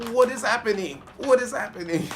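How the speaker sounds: high, loud, baffled cry; hammed-up bewilderment; bewildered outcry. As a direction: act out total confusion at full pitch. Acting out own bewildered reaction.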